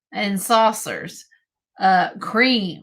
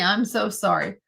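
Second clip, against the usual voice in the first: normal talking voice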